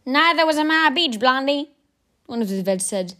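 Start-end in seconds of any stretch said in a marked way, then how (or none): 0.1-1.7 put-on higher gruff old vet; old veteran voice. A narrator's reading. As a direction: tease like a cocky old soldier. Old vet's retort.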